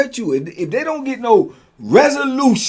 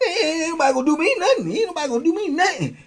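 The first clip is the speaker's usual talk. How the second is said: much higher, laughing mock bravado; mock tough guy; 11 semitones higher, faster than usual. Storyteller mimicking Vito's bravado, high and laughing. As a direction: ham up the boast with a laugh. Vito boasting, mocked.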